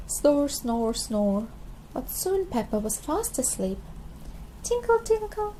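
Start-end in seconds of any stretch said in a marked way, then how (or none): 0.1-1.5 snoring. A narrator's reading. Peppa asleep snoring. 4.6-5.5 high sung tinkle, light and bright; fairy tinkle. A narrator's reading. Fairy magic sound.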